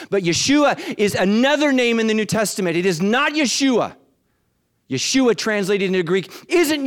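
high, loud preaching; full-throated sermon climax; preaching at full force. Acted. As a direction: preach it out with fire.

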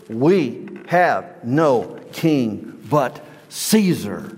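very slow, heavy, deliberate words; slow solemn quote. Acted. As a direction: drag it out like a grave verdict. Chief priests' fateful answer.

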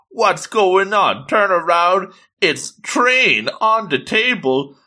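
drawn-out, high-pitched astonishment; excited surprise. Acted. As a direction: play up the sudden reveal. Acting out a sudden surprise reveal.

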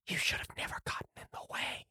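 hushed, menacing possessed voice; sinister whisper. Acted. Possessed passenger threatens the player.

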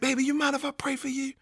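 imitating a nurse in a high, soft voice; tender nurse. Nurse asks the wounded man to pray for him, tender high voice. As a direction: ask gently, pitched well up.

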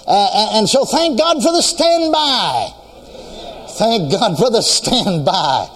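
full-force preaching. Acted. Preacher stirring the congregation about the standby, full-force preaching. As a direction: preach it out with rising fire.

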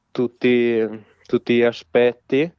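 usual speaking voice

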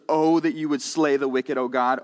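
"Oh, that you would slay the wicked" read out at normal strength; an open, ringing public reading; full scripture reading. Shown as a demonstration. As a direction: declaim the verse plainly to the room. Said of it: "It says".

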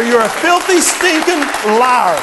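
loud scornful rebuke; angry rebuke. Retold or imitated. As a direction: spit the insult at the devil. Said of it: "and you say".

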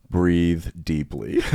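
imitating a friend, each word drawn way out; very slow, stretched instruction; imitated slow coaching. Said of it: "where she goes".

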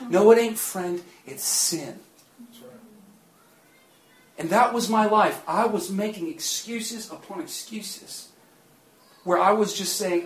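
ordinary talk

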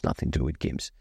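unvoiced low aside; whispered aside. Real, not acted. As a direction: whisper it to yourself. Muttered aside.

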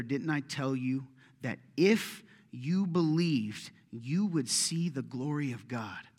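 drawn out, stressing if and you; slow, weighty rebuke. Acted. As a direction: lay each word down deliberately as a gentle rebuke. Jesus rebukes Martha.

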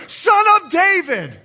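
high, loud shout. Acted. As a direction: raise the cry above everyone. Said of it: "even louder".

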